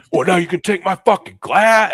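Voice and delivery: acted-out angry outburst, high and loud; angry retort. Wedding guest squaring up over a glass, angry retort. Acting out the escalating confrontation.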